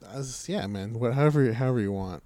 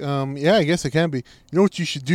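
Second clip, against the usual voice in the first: ordinary talk, nothing marked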